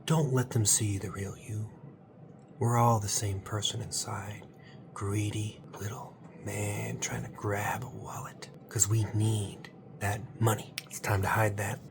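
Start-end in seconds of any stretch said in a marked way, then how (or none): 2.6-11.8 secretive unvoiced whisper; conspiratorial whisper. Comic host whispering a secret tutorial to viewers. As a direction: let them in on a sly secret.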